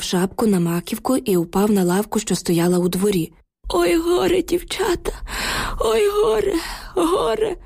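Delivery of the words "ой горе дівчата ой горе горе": wailing lament. A narrator's reading. Old neighbour wails to the girls in grief, crying. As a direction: wail it out like an old man in despair. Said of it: "заголосив він".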